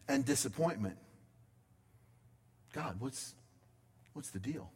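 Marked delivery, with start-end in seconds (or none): 2.7-4.7 much softer, lower, almost to yourself; a hushed, baffled question to God; quiet bewildered question. Acted. Paul's confused aside to God.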